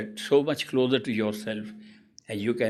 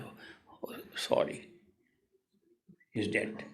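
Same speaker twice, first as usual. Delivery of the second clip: a near-whispered, lowered "sorry, he's dead"; hushed mock condolence; 5 semitones lower, 11 dB quieter, slower than usual. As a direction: break the fake news in a hush. Doctor fakes a death announcement.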